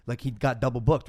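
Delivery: ordinary delivery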